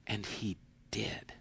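breathy hushed whisper for weight. Acted. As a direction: let it drop to a whisper. Hushed climax of sermon point.

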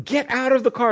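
high, pressing shout; urgent call. Retold or imitated. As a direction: tell him to get out, urgently. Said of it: "the cameraman is going".

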